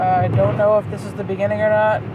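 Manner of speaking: ordinary talk, nothing marked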